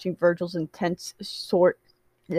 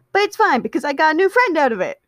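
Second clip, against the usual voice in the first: high, quick, bubbly burst; perked-up excitement; 9 semitones higher, 4 dB louder, faster than usual. A narrator's reading. Patton perks back up over a new friend, high and excited. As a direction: bounce right back with delight. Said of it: "before perking back up".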